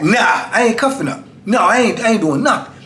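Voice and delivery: retold high-pitched defiance; acting out a stand-off with an officer; defiant refusal.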